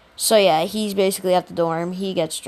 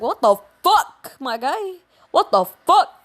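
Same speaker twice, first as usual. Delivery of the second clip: shocked classmates; 6 semitones higher than usual. Retold or imitated. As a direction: squeal out the shock. Shocked classmates in retold story.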